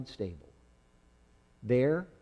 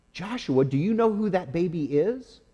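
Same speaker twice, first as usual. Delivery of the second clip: excited question; 7 semitones higher, 4 dB louder than usual. Acted. Shepherds eagerly ask the lamb.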